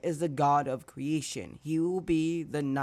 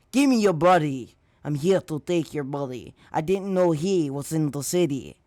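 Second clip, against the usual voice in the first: raised, forceful monster demand; sludge villain voice; 6 dB louder than usual. Acted. Sludge villain attacking Izuku to take his body, forceful villain voice. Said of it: "in that type of voice".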